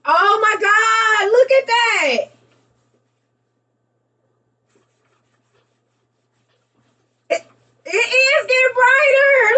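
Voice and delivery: high, loud, slow awed cries; amazed. Real, not acted. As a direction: drag out the wonder. Amazed as bulb gets brighter.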